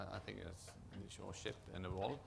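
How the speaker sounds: ordinary talk, nothing marked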